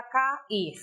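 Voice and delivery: "cair" split into ca-IR; syllables separated. Shown as a demonstration. Teacher isolating the tonic syllable, syllables separated. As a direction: say "cair" in two syllables, stress on the last. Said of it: "Esse ir, de fato".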